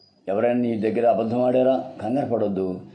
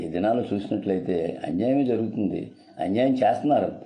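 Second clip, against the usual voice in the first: ordinary talk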